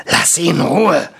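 thundering dwarf command. Acted. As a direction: boom it at the vampire. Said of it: "donnerte Stramm".

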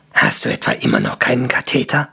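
very low, rough dwarf grunt; low grunted question. A narrator's reading. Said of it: "grunzte Stramm".

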